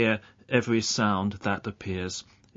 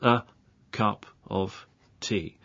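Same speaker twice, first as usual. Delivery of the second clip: "a cup of tea." word by word, a pause between each; very slowly. Shown as a demonstration. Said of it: "I'll say this very slowly".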